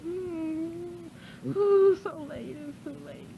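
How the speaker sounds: low, soft, moaning; moaning softly. Real, not acted. Demon describing hell to the minister, low moaning. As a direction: moan it out in misery.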